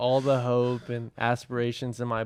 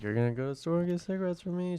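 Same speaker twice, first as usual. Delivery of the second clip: higher, hushed rush of eager asking; retold hyped mom; 5 semitones higher, 9 dB quieter, faster than usual. Son retells his mom's errand request, hyped. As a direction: imitate an eager mom, quick and soft. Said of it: "She was so hyped".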